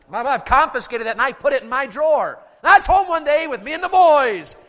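loud, high comic rant; hammed-up, high, indignant holler; exasperated shouting. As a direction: yell the story in mock exasperation. Escalating comic rant about the knife.

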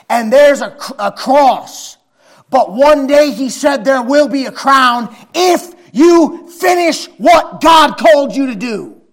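high, loud, slow preaching shout; slow fiery preaching. Acted. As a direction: drive each word home slowly.

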